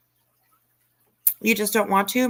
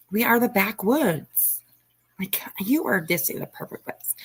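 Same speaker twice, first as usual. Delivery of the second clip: whispering. Pretend. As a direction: whisper it like a secret. Whispered welcome to viewer.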